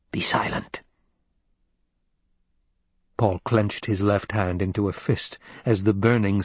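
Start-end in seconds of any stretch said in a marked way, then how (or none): 0.1-0.8 hushed order. Acted. Crone silences the boy.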